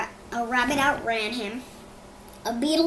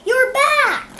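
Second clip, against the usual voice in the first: very high, loud cry of joy; joyful shout; 13 semitones higher, 12 dB louder than usual. A narrator's reading. Michael welcoming her dog home, high and loud. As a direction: burst out with delight. Girl greets returned dog.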